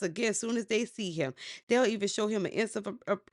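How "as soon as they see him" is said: normal talking voice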